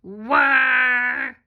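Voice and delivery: big, loud monster voice. Shown as a demonstration. Teacher reading sight words with kids, monster voice. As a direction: boom it out like a monster. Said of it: "use our monster voice".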